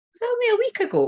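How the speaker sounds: high, surprised double take. Real, not acted. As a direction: jolt up in disbelief at the date. Suddenly unsure of the date.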